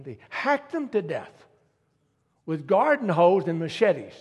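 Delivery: forceful preaching. Acted.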